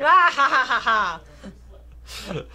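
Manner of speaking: villain laugh. Acted. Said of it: "That's just my generic villain voice".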